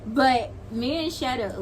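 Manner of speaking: ordinary talk, nothing marked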